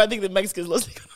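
whispered aside. Real, not acted. Aside after laughing.

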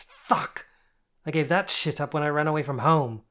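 loud, raging outburst; screeching in rage. A narrator's reading. Jane screeching at Kitty about running away. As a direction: scream it through tears of rage. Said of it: "Jane screeched".

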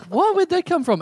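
high-pitched, incredulous retold reaction; startled disbelief. Comic retelling his reply to a stranger's confession, startled. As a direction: act out being thrown, voice leaping way up.

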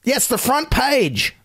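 slow, high mock awe; deadpan sarcasm, stretched and high; sarcastic. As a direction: drag it out as if it were huge news.

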